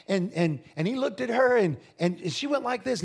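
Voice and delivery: usual speaking voice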